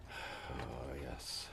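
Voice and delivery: very low, soft aside; absent recollection. Acted. General remembering the nuns' details, quietly to himself. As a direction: recall it quietly, as if to yourself.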